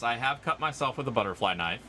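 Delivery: ordinary delivery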